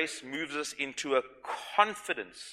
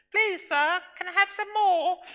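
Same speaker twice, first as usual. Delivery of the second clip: much higher, pinched, put-on orphan's English accent; bad English accent; 7 dB louder than usual. Preacher imitating Oliver Twist asking for more food, high, in a bad English accent. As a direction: squeak it out like a timid Victorian orphan. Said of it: "probably did a bad accent there".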